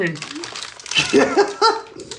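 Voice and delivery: high squealing laugh; high trailing-off laughter; real laughing fit. Real, not acted. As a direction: crack up helplessly. Laughing at viewers' gifts.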